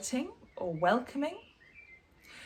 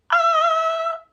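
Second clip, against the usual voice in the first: mock gasp; 12 dB louder than usual. Shocked at covering mouth.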